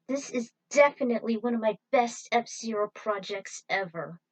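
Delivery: normal talking voice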